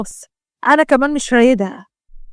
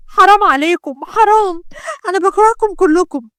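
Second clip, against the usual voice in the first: anguished shout; 6 semitones higher than usual. Acted. Israa shouting at grandfather and family, anguished. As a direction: cry out against the whole family. Girl forced into marriage protests.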